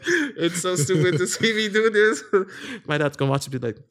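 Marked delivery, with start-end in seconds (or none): 0.1-2.9 high, slowed by laughter; giggling at your own silliness; laughing. Real, not acted. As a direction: laugh helplessly through the words. Laughing at own silly act.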